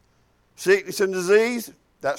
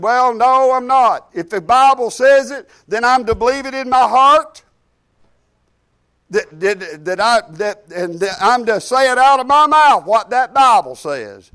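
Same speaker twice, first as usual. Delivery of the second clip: louder, higher defiant answer; emphatic retort; 3 semitones higher, 6 dB louder than usual. Retold or imitated. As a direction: push back hard with every word. Retort to doubters, retold forcefully.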